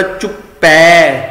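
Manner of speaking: ਚੁੱਪੈ slow and sung, open ai ending; dulavan ending. Shown as a demonstration. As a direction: sing out the ai in ਚੁੱਪੈ. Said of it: "ਚੁੱਪੇ ਨਹੀਂ ਪੜ੍ਹਨਾ". (ਚੁੱਪੈ ਦੁਲਾਵਾਂ ਨਾਲ)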